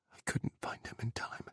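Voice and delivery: barely-voiced whisper; breathed. A narrator's reading. Jack confessing his failure to Sergei, breathed whisper. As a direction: breathe the words out, almost no voice. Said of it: "he breathed".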